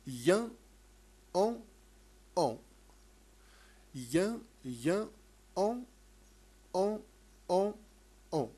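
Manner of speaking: loud drill of the nasal "Yen" and "on"; drilled nasal sounds. Shown as a demonstration. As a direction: bark out each nasal syllable for pupils to repeat. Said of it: "فقط هنا ملاحظة". (نطق تدريبي للأصوات الأنفية)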